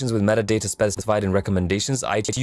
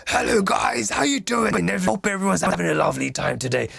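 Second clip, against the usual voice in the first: high-pitched greeting at moderate volume; toned-down screamer; 9 semitones higher than usual. Shown as a demonstration. Teacher imitating the screaming streamer brought to uniform volume, high-pitched. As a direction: pitch it way up without really shouting. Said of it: "the guy screaming is a bit like".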